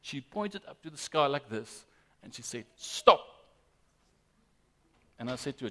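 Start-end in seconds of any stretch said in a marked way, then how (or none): 2.8-3.2 sharp, higher, louder one-word order; child's command. Retold or imitated. Father voices his small daughter ordering the rain, commanding.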